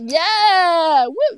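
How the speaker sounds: loud, drawn-out party whoop; excited cheering. Retold or imitated. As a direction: cheer like an up-for-anything travel buddy. Voicing upbeat companion's cheering.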